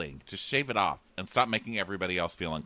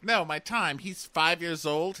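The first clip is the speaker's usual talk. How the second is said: high mock exasperation; raised-pitch parody mom; exasperated mom; 9 semitones higher than usual. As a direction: imitate a mom spelling it out.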